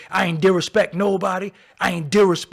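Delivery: disrespect said as "dih-rih-spect", mocked; mocking rapper's speech. Mocking how T I says disrespect, loud mock pronunciation. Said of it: "He can't say disrespect".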